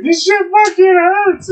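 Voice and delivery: high, loud, slowed complaint of pain; mock pained shout. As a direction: wail it like a stubbed toe. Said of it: "I'm like".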